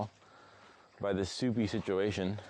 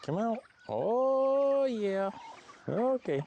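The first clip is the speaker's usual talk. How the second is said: high coaxing; 12 semitones higher, much slower than usual. Real, not acted. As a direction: coax the birds out sweetly. Coaxing the teenagers out.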